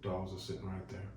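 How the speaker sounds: usual speaking voice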